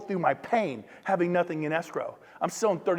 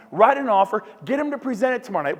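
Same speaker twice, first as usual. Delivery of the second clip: usual speaking voice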